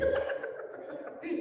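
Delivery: quick unvoiced chuckle; short breathy laugh. Real, not acted. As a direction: let a little laugh slip out. Preacher laughs at his own joke. (risita breve)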